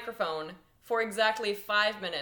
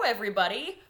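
ordinary delivery

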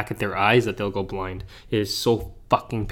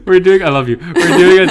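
high, loud, helpless laughing speech; 7 semitones higher, 11 dB louder than usual. Real, not acted. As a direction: struggle to get the words out while cracking up. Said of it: "Stop laughing at me".

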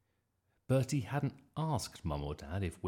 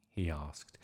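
plain reading voice

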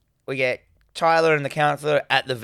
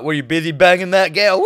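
mocking taunt; 4 semitones higher, 7 dB louder than usual. Kids taunting the counselor.

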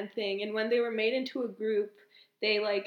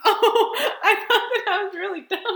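laughing; 8 semitones higher, 9 dB louder than usual. Real, not acted. Relieved laugh after math joke.

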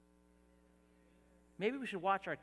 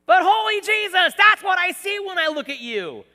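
much higher, much louder cry of praise; preacher's shout; 20 dB louder than usual. Acted. Pastor shouting to his congregation that he sees their Savior. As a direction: shout it to the rafters. Climax of the sermon.

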